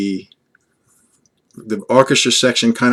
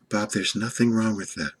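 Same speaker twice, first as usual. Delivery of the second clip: soft Lana voice; 8 dB quieter than usual. Pretend. Lana answers Bob back.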